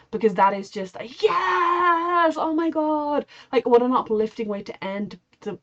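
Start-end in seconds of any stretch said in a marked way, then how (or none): 1.0-3.3 high, drawn-out elated yes; thrilled outburst. Acted. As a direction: cheer it out high, stretching the yes. Acting out thrill at imagined win.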